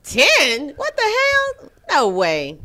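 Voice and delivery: loud, high-pitched outburst of disbelief; shocked disbelief. Real, not acted. Woman reacting to ten men as the minimum, shocked. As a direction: blurt it out, loud and incredulous.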